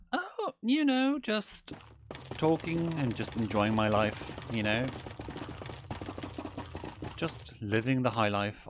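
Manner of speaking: high, slow airy voice on Oh, you know; carefree posh character. Pretend. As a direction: drawl it like a pampered socialite.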